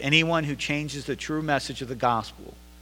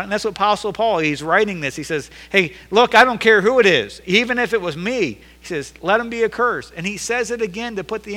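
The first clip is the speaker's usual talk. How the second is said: loud, high, driving pulpit delivery; fiery sermon climax; full-force preaching; 6 semitones higher, 9 dB louder than usual. Acted. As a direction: preach it hard to the congregation. Preacher at full force quoting Paul.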